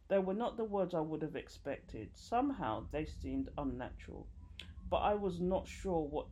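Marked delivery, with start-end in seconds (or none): none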